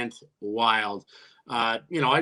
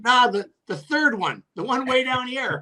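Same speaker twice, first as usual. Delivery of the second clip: high, teasing correction; mock helpful; 9 semitones higher than usual. Player needling the coach about his chins, high and teasing. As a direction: pitch it up as if helpfully pointing.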